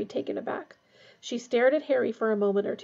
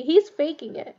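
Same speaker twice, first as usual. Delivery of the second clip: raised, louder accusation; furious; 5 semitones higher, 5 dB louder than usual. A narrator's reading. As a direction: blurt out the angry accusation. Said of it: "said Harry furiously".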